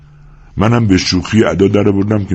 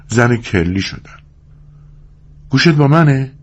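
higher-pitched woman's voice, mildly eager; eager storytelling wife; 8 semitones higher than usual. Acted. Curley's wife telling Lennie her story, eager. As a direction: voice a woman confiding her lost film dream.

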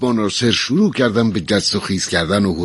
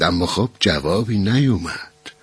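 plain reading voice

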